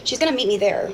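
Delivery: ordinary talk, nothing marked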